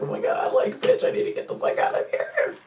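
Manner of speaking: high, unvoiced whisper of wanting out; whispered panic. Stuck on the bed, whispering she must leave. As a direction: whisper it urgently to yourself.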